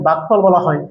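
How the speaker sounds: ordinary delivery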